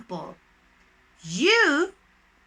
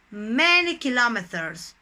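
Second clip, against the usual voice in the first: ordinary talk, nothing marked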